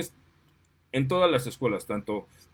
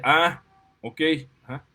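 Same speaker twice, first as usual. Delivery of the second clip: defiant retort; 5 dB louder than usual. Retold or imitated. Student confronts the teacher.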